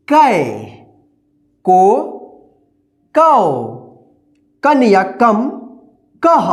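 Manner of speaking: teacher drilling the barakhadi aloud; slow recitation drill. Shown as a demonstration. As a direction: recite each syllable slowly with long pauses. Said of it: "एक बार और हम इसको पढ़ाते हैं".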